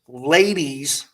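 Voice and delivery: ordinary talk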